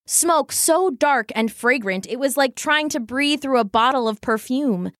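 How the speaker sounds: usual speaking voice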